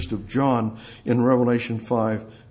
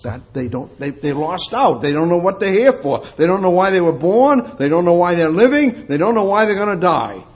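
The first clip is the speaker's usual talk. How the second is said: louder, higher preaching in driving repeated phrases; impassioned preaching; 5 semitones higher, 5 dB louder, faster than usual. Real, not acted. Preacher lamenting that people don't know why they're born, impassioned. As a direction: build the repetitions like a fervent preacher.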